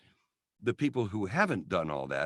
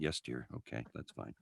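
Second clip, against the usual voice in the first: much lower, softer meek yes dear; meek mumble; 11 semitones lower, 14 dB quieter than usual. Retold or imitated. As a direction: shrink into a hushed sheepish reply. Meek reply to scolding wife.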